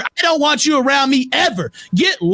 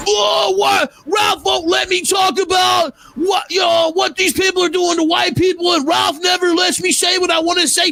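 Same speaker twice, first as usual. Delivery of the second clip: high, shouted whiny mockery of Dingo; mocking whiny Dingo; 6 semitones higher than usual. Host mocking a guest's complaint, high shouted whine. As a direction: squeal it like a whining complainer. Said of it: "And Dingo's".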